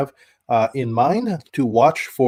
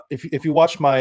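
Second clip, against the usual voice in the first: ordinary delivery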